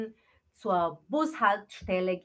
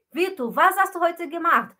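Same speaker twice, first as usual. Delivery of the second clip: raised, higher question to the class; calling on student; 7 semitones higher, 9 dB louder than usual. Acted. Teacher asks Vitor loudly, higher pitch.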